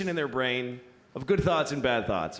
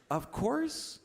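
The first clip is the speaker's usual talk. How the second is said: higher-pitched, gently earnest delivery; righteous high voice; 4 semitones higher than usual. Acted. Voicing what he believes about nut allergies, high and earnest. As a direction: float it up high, the dutiful good thought. Voices the 'of course' good thought.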